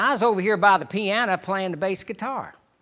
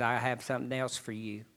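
gentle and much softer, low and tender; lower, softer, sweet reassurance; sweetly; 8 semitones lower, 12 dB quieter than usual. Retold or imitated. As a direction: speak it softly, like a loving whisper to the heart. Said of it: "And just sweetly again".

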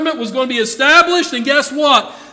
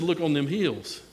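quiet reply; 7 semitones lower, 9 dB quieter than usual. Retold or imitated. The Lord answers, quiet.

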